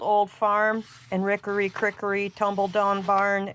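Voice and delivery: ordinary delivery, nothing marked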